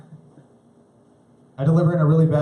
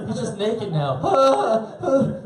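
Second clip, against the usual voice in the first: slow laughing delivery; 8 semitones higher, much slower than usual. Real, not acted.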